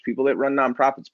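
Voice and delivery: ordinary talk, nothing marked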